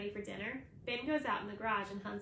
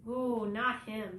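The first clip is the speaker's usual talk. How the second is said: slow, louder scornful snort of a line; 7 dB louder, much slower than usual. A narrator's reading. Ben scoffing about the school bully to his friend, slow and snorting. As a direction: scoff at the name with disgust. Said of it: "Ben snorts".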